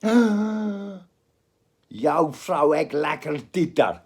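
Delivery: a drawled Afrikaans line, slowed right down; South African Afrikaans phrase. Retold or imitated. To the mates, showing off a rude Afrikaans phrase, slow and thick. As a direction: say it like a local teaching rude Afrikaans. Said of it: "That means your wife has nice boobies".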